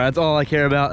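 ordinary talk

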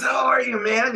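put-on old friend's beaming reply; delighted greeting. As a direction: throw back an overjoyed welcome. Player greets him back warmly.

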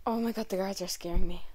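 ordinary talk

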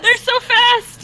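high, loud, drawn-out wail; overwhelmed alarm. Real, not acted. Player swamped by fast enemies, high loud alarm. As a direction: wail slowly over speedy attackers.

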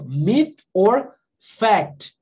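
very slow, each word set apart; slow pronunciation model. Shown as a demonstration. Teacher showing students how it is pronounced, very slow. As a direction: model it word by word with long gaps.

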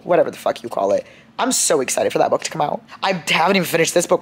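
usual speaking voice

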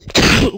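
short real cough mid-lecture; a single dry cough. Real, not acted. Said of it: "sono un po' malata".

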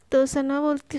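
plain reading voice

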